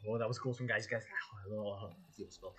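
ordinary delivery

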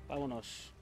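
ordinary delivery

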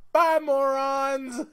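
high, loud, slow put-on voice. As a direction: drag it out like a cocky raccoon. Retelling [name]'s parting insult.

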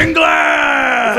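high, hammed-up announcer shout; mock stadium bellow, drawn out; over-the-top arena announcer. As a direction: holler the surname like rallying the troops. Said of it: "trying to rally the troops".

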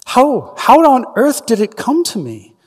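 high, anxious stammering question; frightened Frodo. Acted. Frodo asking Gandalf how the ring came, high and frightened. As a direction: pitch up, scared and pleading.

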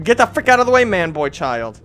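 high, loud outburst easing off; impatient yell. Real, not acted. Player ordering man boy child aside, impatient. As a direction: yell it to clear the path.

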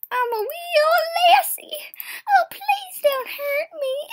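high, wailing Scottish granny; lame old lassie impression. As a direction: whimper like a frightened wee old lassie. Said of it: "my lame impression".

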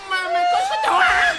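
very high-pitched cry of disbelief; shocked outburst. Acted. Reacting to news of a pregnancy in the family, shocked outburst. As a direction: shriek the question in shock.

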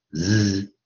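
Z as a long voiced buzz, zzz; voiced Z sound. Shown as a demonstration. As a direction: demonstrate Z as a sustained voiced z sound. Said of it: "发音为浊音". (Z 发成拉长的浊音 zzz)

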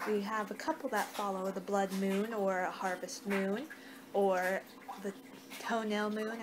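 ordinary talk

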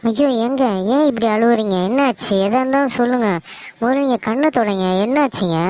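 high-pitched, anxious wifely fussing; alarmed concern. Acted. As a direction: plead to know what is wrong. Wife finds husband crying. (பதறிப்போய் கவலையுடன்)

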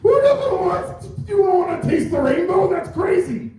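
squeaky high offended whine; offended weirdo voice. Retold or imitated. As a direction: whine it like a wounded stranger. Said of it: "He was like".

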